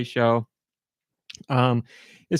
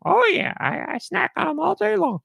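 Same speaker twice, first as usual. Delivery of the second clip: much higher cheerful little voice; squeaky George voice. Acted. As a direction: pitch way up into a happy squeaky character. George answers again.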